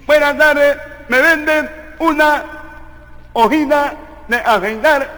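halting, heavily nasal speech; comic nasal voice with blurred consonants; nasal-voiced man. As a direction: talk through your nose, word by word. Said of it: "va un gangocito a la botica".

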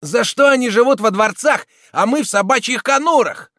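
high, loud goading voice; wily agitator. A narrator's reading. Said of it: "ввернул пронырливый человек".

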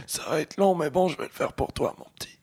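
put-on high, quavering elderly voice; old person voice. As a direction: talk like a frail grandmother. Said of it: "Tu peux parler avec une voix de vieille personne?".

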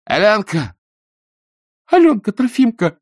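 high, loud frantic cry; alarmed mother. A narrator's reading. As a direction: shield the kids, crying out. Mother shields children, cries out. (испуганный крик матери)

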